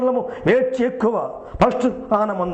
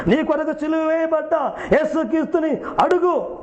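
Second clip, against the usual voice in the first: higher, fervent preaching appeal; pleading altar call; 5 semitones higher than usual. Acted. Preacher urging the congregation to love Jesus, fervent preaching appeal. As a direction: press each నీ కొరకు home to the listener.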